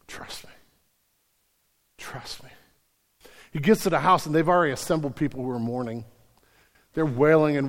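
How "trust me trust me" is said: whispered reassurance. Retold or imitated. Jesus whispering reassurance to the grieving father. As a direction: breathe it as a quiet promise.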